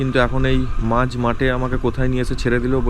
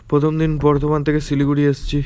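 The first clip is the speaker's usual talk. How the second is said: normal talking voice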